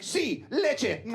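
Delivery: put-on high little-kid voice in Spanish; twelve-year-old boy. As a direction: pipe up like a small child asking for milk. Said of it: "for a twelve-year-old boy".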